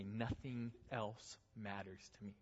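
inner voice. Acted. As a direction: say it slowly, as if privately to yourself. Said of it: "in your heart and in your mind, you say".

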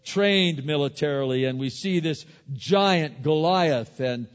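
loud, high, driving preacher's voice; full-force preaching. Acted. Preacher retelling David and Goliath, loud and rousing. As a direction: preach it out to the whole congregation.

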